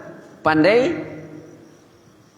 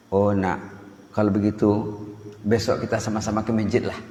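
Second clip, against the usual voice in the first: lower, subdued conceding reply; chastened parent giving in; 5 semitones lower, 4 dB quieter than usual. Retold or imitated. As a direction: give way to the child, pitch dropped. Ayah luluh dan menyerah. (ayah luluh, suara rendah mengalah)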